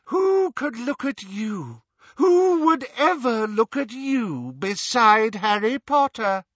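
high, loud, drawn-out scorn; scornful taunting. Acted. Riddle Hermione belittling Ron beside Harry, scornful taunt. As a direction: sneer it high, stretching the key words.

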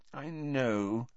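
quietly comforting a disappointed friend; soothing. Acted. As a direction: soothe him gently. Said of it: "said Hermione soothingly".

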